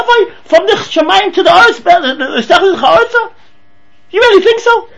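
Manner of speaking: high, loud mocking scoff at the dream; scornful father. Father scoffs at son's dream, high and loud. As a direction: scoff like an outraged father who can't believe it.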